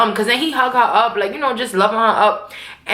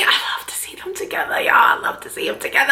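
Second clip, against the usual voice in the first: hushed, delighted whisper; gushing whisper; 3 dB quieter than usual. Real, not acted. Fan savoring Ghost and Tommy together, gushing whisper. Gushing over favorite pair.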